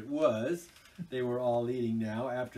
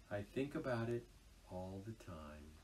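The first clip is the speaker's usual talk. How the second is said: pathetic lower tone; 4 semitones lower, 9 dB quieter than usual. Acted. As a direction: let the homesickness show quietly. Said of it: "pathetically in a lower tone".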